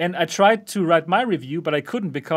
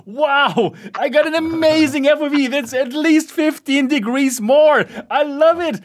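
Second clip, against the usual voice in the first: high, loud gushing; mock excited gushing; 8 semitones higher, 5 dB louder than usual. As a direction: gush like an amazed reviewer. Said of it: "in Steve's video".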